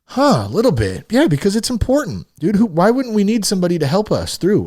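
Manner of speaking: high-pitched, mildly pissed-off insistence; exasperated rant, pitch jumping up; worked up. Real, not acted. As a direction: argue the point, getting heated. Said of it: "starting to get pissed off about it".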